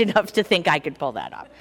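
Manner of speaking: normal talking voice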